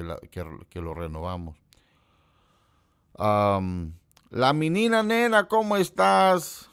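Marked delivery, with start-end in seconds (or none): 4.3-6.7 excited greeting. Real, not acted. Greeting a friend who just joined the stream, high and loud. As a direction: sing out a delighted hello to a friend.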